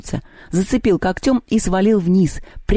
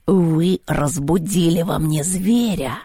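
slow menacing cat; through clenched teeth; much slower than usual. A narrator's reading. Cat threatening those who woke the beast, through clenched teeth. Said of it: "сообщил кот сквозь зубы".

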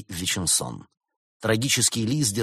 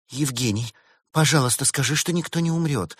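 plaintive pleading; 7 semitones higher, 3 dB louder than usual. A narrator's reading. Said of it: "жалобно взмолилась Берта".

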